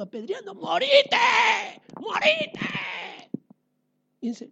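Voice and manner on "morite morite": high angry yell; mob's yell. Acted. The stoning mob to Stephen, a high angry yell. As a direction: scream for his death like the mob.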